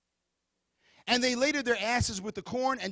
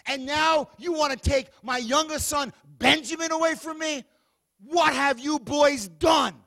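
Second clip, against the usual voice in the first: loud, higher, scolding shout; Jacob's anguished anger; 6 semitones higher, 9 dB louder than usual. Acted. Jacob to his sons, grieving and furious, shouting. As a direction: tear into the boys.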